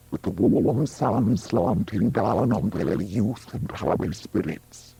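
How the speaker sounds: ordinary delivery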